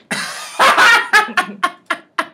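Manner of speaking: loud high laugh. Real, not acted. Big laugh alone.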